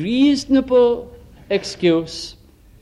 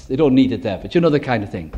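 normal talking voice

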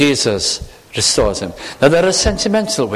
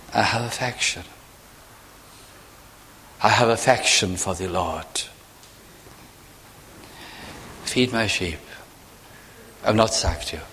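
much softer, lower, subdued confession; humbled and quiet; 4 semitones lower, 8 dB quieter than usual. Retold or imitated. Humbled Peter answering Jesus, soft and low. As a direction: let the boast drain away.

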